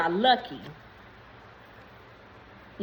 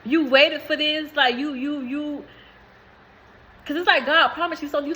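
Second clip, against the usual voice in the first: moved and earnest; 4 semitones higher than usual. Real, not acted. Telling the viewer, moved, that they waited. Emotional reading, near tears.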